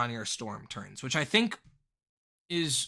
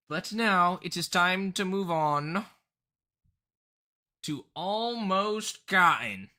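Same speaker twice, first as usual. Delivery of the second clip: put-on slow, higher southern accent announcement; 6 semitones higher, 3 dB louder, slower than usual. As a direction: drawl the name in a southern accent. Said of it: "I always do the southern accent for him".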